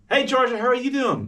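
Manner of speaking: very high, sing-out hello; over-friendly greeting. Acted. Interviewer greeting the tech person on Zoom, high and bubbly. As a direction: greet them with big upbeat energy. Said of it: "I'm giving that".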